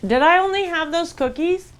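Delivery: high, loud realization; incredulous. Real, not acted. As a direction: catch yourself in disbelief. Shocked at own eating.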